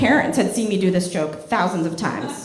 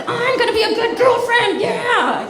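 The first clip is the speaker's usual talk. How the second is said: put-on eager girlfriend; 12 semitones higher than usual. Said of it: "so I was like".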